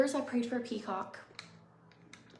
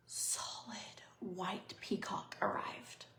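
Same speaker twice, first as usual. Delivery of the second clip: hushed awe; 8 dB quieter, slower than usual. Real, not acted.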